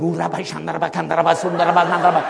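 a hint of a scared little murmur of gibberish; nervous mumbling. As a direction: mutter nonsense like a frightened prayer. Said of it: "this little voice going".

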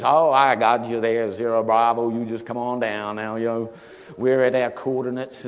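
a laid-back voice from the airfield; drawling radio reply. Retold or imitated.

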